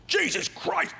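high, breathy exasperated dad; dad's angry curse. Retold or imitated. Drunk father curses at his son striking out, high and breathy. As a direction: hiss it out disgusted.

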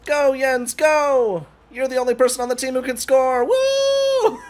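high, loud shouts rising to a whoop; excited cheering. Real, not acted. Player cheering on the only teammate who can score, excited shouting. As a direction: cheer your teammate on at full voice.

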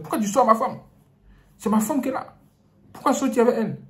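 high loud accusing voice; angry husband. Acted. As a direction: hurl the accusation. Husband keeps accusing priest.

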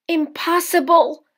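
high, loud cry of frustration; the mother voice, giving up on the peas; exasperated mother. A narrator's reading.

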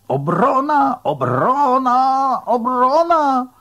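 high, drawn-out fan chant shout; throat-straining cheer. A narrator's reading. Gretchen cheering on the football defence, throat-straining shout. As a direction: yell it at full stretch for your team. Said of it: "zdzierała sobie w gardle strunę".

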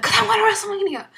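sudden high shout of joy; excited yell. Real, not acted. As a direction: let it burst out, loud and high.

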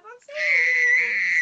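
excited shout. Real, not acted.

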